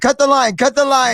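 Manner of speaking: scared order. Retold or imitated. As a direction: beg them to cut the line. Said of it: "I said".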